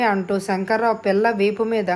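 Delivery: plain reading voice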